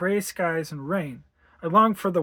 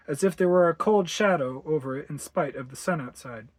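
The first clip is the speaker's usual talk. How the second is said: ordinary delivery, nothing marked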